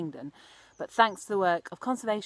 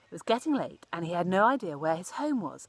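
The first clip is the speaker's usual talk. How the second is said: usual speaking voice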